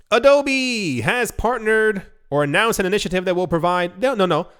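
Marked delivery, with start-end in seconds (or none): none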